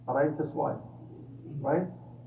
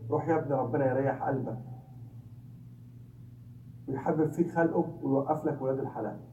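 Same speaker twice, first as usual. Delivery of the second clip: fast Egyptian Arabic, like a mother's blessing; Egyptian Arabic dialect; 2 semitones lower, 3 dB quieter, much faster than usual. Retold or imitated. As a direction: rattle off the Egyptian Arabic dua quickly. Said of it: "in the Egyptian dialect".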